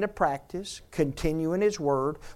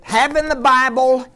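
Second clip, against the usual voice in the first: louder, higher preaching with slow rhetorical questions; full-force preaching; 4 semitones higher, 10 dB louder, slower than usual. Acted. Pastor urging the congregation toward the truth, preaching hard. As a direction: drive it home like a revival preacher.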